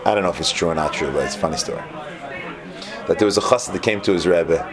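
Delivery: ordinary talk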